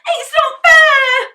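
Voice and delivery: high, loud, slow crying shriek. A narrator's reading. Henry shrieking at Dad, crying and loud. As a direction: shriek it through tears. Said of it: "shrieked Henry".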